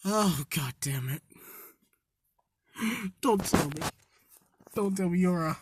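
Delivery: hushed, dawning dread; quiet, dismayed half-whisper. Retold or imitated. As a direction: mutter it as the awful truth sinks in. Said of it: "Bakugo's like".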